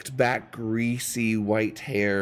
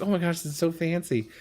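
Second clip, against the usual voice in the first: gushing tourist voice; 8 semitones higher, faster than usual. Retold or imitated. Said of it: "everyone kinda is like".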